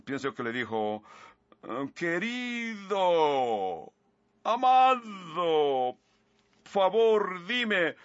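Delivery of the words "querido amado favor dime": a lightly put-on, higher, sweet pleading woman's voice; Delilah's coaxing voice. Preacher voicing Delilah begging Samson, mild high sweet coax. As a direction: coax him sweetly, a bit higher than your own voice. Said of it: "creo que ni lo puedo imitar".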